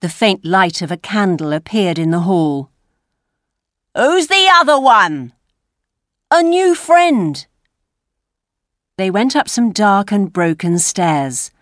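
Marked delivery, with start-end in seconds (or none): 3.9-5.3 loud, high challenge; crying out. A narrator's reading. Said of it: "a voice cried out". 6.3-7.5 put-on high, drawn-out boy's reply; street boy. A narrator's reading. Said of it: "said the Dodger".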